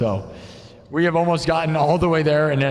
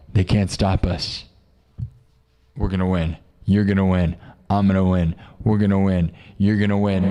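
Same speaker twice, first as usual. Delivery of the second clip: much lower, dark spooky voice; spooky dark mode; 10 semitones lower than usual. Acted. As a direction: sink down deep into a spooky, menacing chant. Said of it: "I'm going into spooky dark mode".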